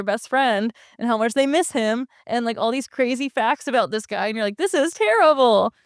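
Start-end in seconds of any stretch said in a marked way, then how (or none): 4.6-5.7 higher, slow mock-appalled exclamation; appalled reaction. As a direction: draw it out slowly, raised pitch, imitating dismay. Retold appalled reaction to sad facts.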